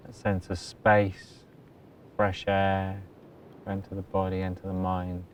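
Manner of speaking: very soft, low-key meditation voice with long pauses; hushed, even delivery, letting each phrase settle; guided meditation. Acted. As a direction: guide them gently into stillness. Leads a meditation.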